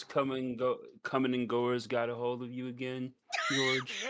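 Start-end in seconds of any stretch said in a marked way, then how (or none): 3.5-4.1 name called out through laughter; teasing, breaking into a laugh. Real, not acted. Player teases with laughing shout.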